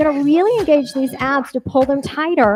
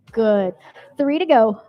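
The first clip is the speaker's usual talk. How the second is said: normal talking voice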